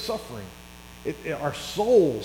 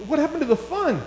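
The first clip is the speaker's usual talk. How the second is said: bewildered; 10 semitones higher than usual. Retold or imitated. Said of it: "I was like".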